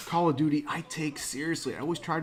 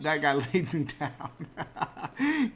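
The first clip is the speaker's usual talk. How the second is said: laughing; much slower than usual. Real, not acted. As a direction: laugh it off after being taken down. Laughs after getting killed.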